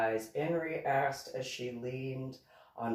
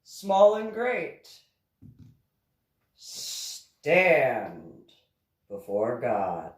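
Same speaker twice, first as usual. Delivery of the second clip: grinning; 6 semitones higher, 7 dB louder, slower than usual. A narrator's reading. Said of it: "added with a small grin".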